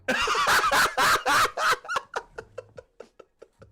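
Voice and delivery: big high burst of laughter; howling laugh; loud laugh. Real, not acted. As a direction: crack up out loud at the news. Streamer cracks up at result.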